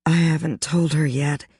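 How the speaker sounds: ordinary delivery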